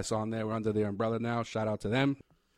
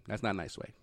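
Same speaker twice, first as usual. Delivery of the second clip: normal talking voice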